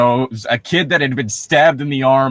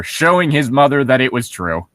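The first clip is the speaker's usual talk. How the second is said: ordinary delivery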